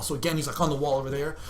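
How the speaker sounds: normal talking voice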